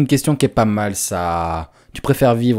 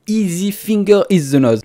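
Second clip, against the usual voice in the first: mock English accent; 8 semitones higher, slower than usual.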